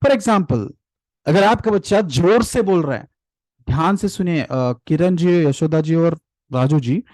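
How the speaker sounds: normal talking voice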